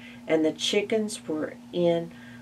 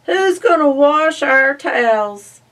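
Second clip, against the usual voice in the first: whining pig; 9 semitones higher, 11 dB louder than usual. Acted. Pig whining after family.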